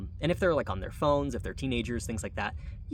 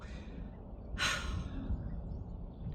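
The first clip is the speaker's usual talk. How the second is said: slow breathy sigh out; long sigh; 6 dB quieter than usual. Real, not acted. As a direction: let out a long weary sigh. Long sigh before reflection.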